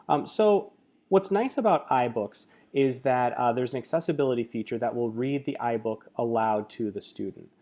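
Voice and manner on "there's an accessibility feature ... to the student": normal talking voice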